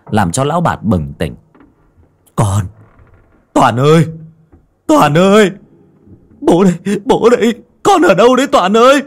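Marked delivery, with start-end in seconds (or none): 2.3-9.0 higher and louder, calling out frantically; desperate shouting. Acted. Father searching in the dark, shouting for his son. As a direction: yell across the dark for your son. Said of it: "Lão Bạt đứng dậy gào thét".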